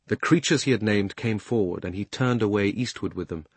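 usual speaking voice

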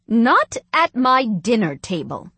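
loud, slow, emphatic scolding; firm maternal warning; stern mother. A narrator's reading. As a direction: lay down the law word by word. Mother scolds children at dinner.

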